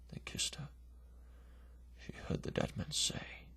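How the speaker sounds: usual speaking voice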